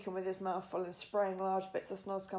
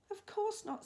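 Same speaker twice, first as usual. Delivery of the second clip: put-on high reassuring girl; bright little girl reply; 13 semitones higher than usual. A narrator's reading. Sophie reassures.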